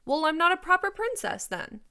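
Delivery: high, loud indignant retort; snapping. A narrator's reading. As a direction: snap back as a defiant princess. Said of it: "Cimarine snapped".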